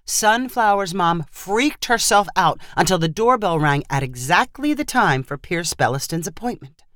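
usual speaking voice

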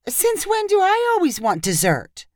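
high-pitched innocent protest; feigned innocence. A narrator's reading. Inez dodging friend's suspicion, feigned innocence. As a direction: play dumb, pitched well up.

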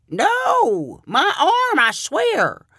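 put-on high, pleading old man voice; insistent old farmer. A narrator's reading. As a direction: swear to it, high. Milford insists on fish size.

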